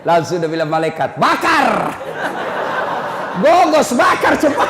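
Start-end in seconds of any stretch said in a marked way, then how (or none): 1.2-4.7 high, loud barked command with a laugh; angel shouting orders. Acted. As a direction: yell it like a furious angel. Angel orders the body burned.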